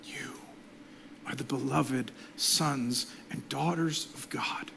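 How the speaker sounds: slow, intimate whisper. Real, not acted. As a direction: lean in and whisper the blessing slowly. Pastor blesses the congregation.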